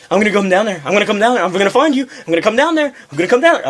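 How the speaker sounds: high-pitched mock tirade, climbing with each repeat; retold threatening yell. Angry customer threatens the clerk by phone, mock yelling. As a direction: imitate her yelled threat, pitch rising. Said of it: "then she starts yelling at me".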